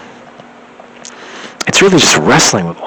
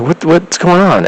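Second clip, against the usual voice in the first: baffled alarm; 10 semitones higher, slower than usual. Retold or imitated. Phone call hung up on her.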